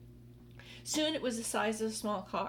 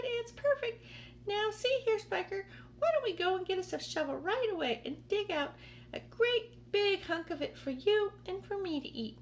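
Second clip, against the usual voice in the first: greedy aunt; 11 semitones higher than usual. Acted. Aunt Spiker urging a shovel for the peach, high eager voice. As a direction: beg to dig in.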